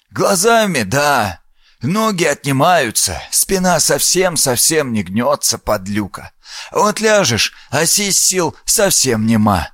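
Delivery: put-on a sly, frail old man rambling. Old villager Shent speaks.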